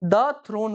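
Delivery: द with a short, neutral vowel before थ्रोन; "thuh" before a consonant. Shown as a demonstration. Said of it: "क्या बोलेंगे?".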